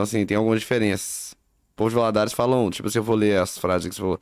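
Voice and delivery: normal talking voice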